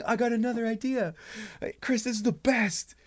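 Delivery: high-pitched, gushing enthusiasm; excited colleagues. Acted. Writers praising a pitch in the ideal reaction, thrilled. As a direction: rave as if the idea is a sure hit.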